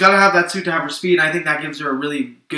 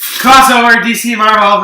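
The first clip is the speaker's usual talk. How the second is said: excited; 7 semitones higher, 9 dB louder than usual. Real, not acted. Excited crossover riff.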